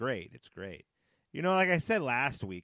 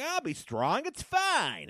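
high-pitched, hammed-up brave face; mock bravery; 3 dB louder, slower than usual. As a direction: put on a strained, squeaky show of coping. Acts out pretending to be brave.

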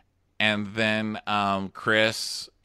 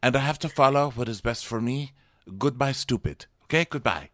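mock Bachelor accent; 4 semitones higher than usual. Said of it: "he's like".

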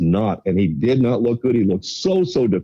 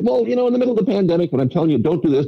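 fast, much higher mock of Trudeau; a sped-up, high-pitched sarcastic Trudeau impression; 9 semitones higher, faster than usual. Mocking Trudeau's double standard.

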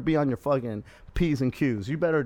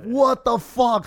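shocked; 7 semitones higher, 4 dB louder, much slower than usual. Retold or imitated. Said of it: "now we're like".

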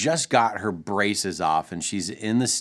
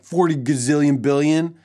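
higher, louder imitation of a daughter; emphatic child's answer; 5 semitones higher, 5 dB louder than usual. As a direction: blurt it out like an eager kid. Daughter's exaggerated answer, retold.

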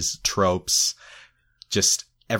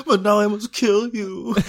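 booming mock villain line; loud, hammy supervillain threat; 14 semitones higher, 5 dB louder than usual. Continuing the Bond villain bit.

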